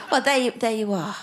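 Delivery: usual speaking voice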